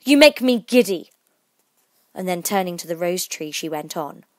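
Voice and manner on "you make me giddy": high, loud irritated queen; imperious, raised-voice complaint; screamed complaint. A narrator's reading. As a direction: snap it out loudly. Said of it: "screamed the Queen".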